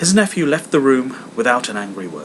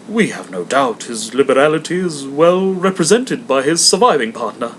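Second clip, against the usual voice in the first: a courteous charity collector; hammed-up polite visitor; portly gentleman; 4 semitones higher, 6 dB louder than usual.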